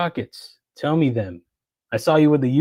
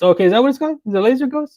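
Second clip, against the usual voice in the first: much higher, louder, caught off guard; real surprise; 12 semitones higher, 6 dB louder, faster than usual. Real, not acted. Streamer hearing the knife's name from chat, surprised and high. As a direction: blurt it out, genuinely surprised.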